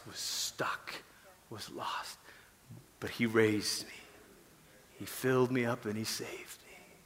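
very soft, low, near-whispered confession; hushed testimony. Acted. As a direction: drop to a reverent hush, almost whispering. Hushed testimony of being saved.

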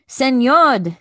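high, loud toucan squawk. A narrator's reading. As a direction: call out loudly like a big bird. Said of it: "squawked the toucan".

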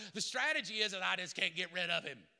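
ordinary delivery

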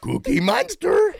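very high, drawn-out puppet voice; Cookie Monster. Acted. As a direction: stretch it out in a high silly voice. Impression contest, puppet character.

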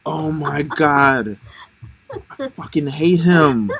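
slow, stretched-out imitation of bitterness; mock a sore loser, dragging the words; bitter. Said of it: "like, he's so bitter".

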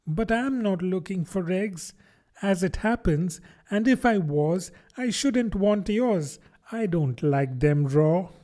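put-on high, pleading child voice; young Alice protesting her innocence; earnest little girl. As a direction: reason politely but urgently. Alice denies wanting eggs.

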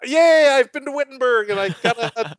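high, loud gushing tourist; mock tourist boast. Mocking a bragging tourist. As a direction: brag like a giddy tourist.

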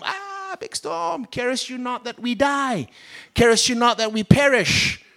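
high, hushed frantic pleading; high fearful mock wail; panicked disciples. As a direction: cry out in mock terror of the storm.

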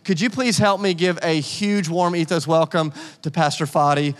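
normal talking voice

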